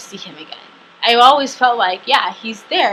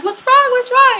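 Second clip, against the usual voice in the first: high-pitched, louder worried questions; consoling worker; 6 dB louder than usual. Retold or imitated. Hostel workers patting the crying guest, high concerned voice. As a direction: imitate a fussing helper, voice way up. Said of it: "trying to console me".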